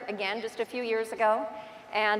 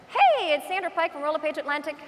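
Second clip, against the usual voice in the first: put-on chirpy greeting, pitched up; video greeting; 5 semitones higher than usual. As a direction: act out an eager self-introduction on camera. Acting out her first video take.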